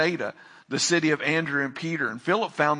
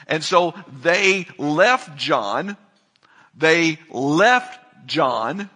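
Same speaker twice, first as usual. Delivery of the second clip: "left John" drawn out slowly and louder; slow emphasis; 5 dB louder, much slower than usual. Real, not acted. Preacher drives home the disciples leaving John, slow and emphatic. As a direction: let "They left John" land, word by word. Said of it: "Are you getting it?".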